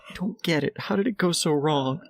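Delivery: high, mock-tearful lament; crying, upset. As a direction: imitate an upset leader close to tears. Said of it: "crying. She's upset".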